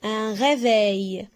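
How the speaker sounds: Un réveil said slowly, syllables drawn out; slow model word. Shown as a demonstration. Teacher shows pupils the word, slow and clear. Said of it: "teacher models word".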